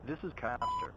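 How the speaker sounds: ordinary talk, nothing marked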